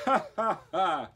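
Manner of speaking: loud, high scornful ha ha ha; mocking laugh. Foreman laughing at the bear's claim, loud and mocking. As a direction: laugh him off. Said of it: "The foreman laughed".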